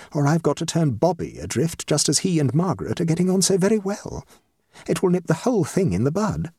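mildly dramatic, aggrieved hostess; distressed hostess. Acted. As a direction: play up a society mother's woes.

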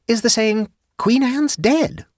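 high woman's voice, drawn out on "dead"; Jane reciting. A narrator's reading. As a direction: recite the saying slowly in a high voice. Jane quotes the saying, realising.

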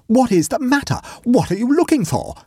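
high-pitched, startled questioning; astonished. A narrator's reading. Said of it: "asked the awakened and astonished Waldo".

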